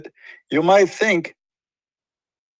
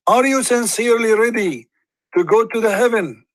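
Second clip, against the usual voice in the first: raised, lilting preacher's question; the Prophet asking; 7 semitones higher than usual. Retold or imitated. Retelling a Hadith to viewers, voicing the Prophet's lofty question. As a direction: ask it like a grand solemn test. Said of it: "The Prophet said again".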